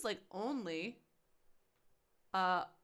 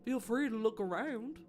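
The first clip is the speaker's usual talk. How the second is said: put-on H H Holmes's accent; 3 semitones higher than usual. Killer fobbing off the furniture man in a put-on accent. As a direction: play dumb in a put-on accent. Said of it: "I've given H H Holmes his accent".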